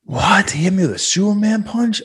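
high, stunned, half-laughing voice; dazed disbelief. Retold or imitated. Friend imitating the concussed fighter in the back room, high stunned disbelief. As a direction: play the knocked-out friend hearing the news for the first time. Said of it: "You're like".

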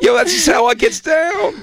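put-on high, shouted kid swagger. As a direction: show off like a little kid. Mocking kid nephews.

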